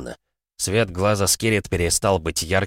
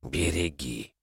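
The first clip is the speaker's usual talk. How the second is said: much softer gentle request; soft plea; 4 semitones lower, 9 dB quieter, much slower than usual. A narrator's reading. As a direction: entrust it quietly. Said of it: "попросила она". (тихая просьба)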